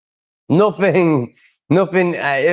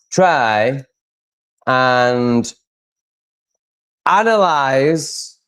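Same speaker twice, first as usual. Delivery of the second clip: slow, spelled-out plea; much slower than usual. Real, not acted. Exasperated plea to chat viewers.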